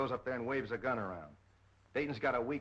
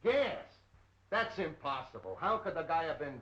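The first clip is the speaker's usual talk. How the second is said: jolted into disbelief; surprised; 4 semitones higher than usual. Acted. Detective hears coroner's poison verdict.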